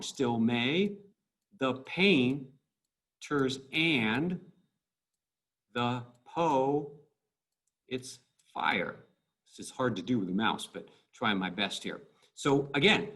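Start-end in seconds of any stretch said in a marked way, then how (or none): 0.0-9.0 "Still may, the pain, ters and" in pairs, pausing after each; weak-strong iambs. Shown as a demonstration. Teacher marks the iambs of a line, halting weak-strong pairs. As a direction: lean on the second syllable in "the po", then a long break. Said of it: "weak, strong".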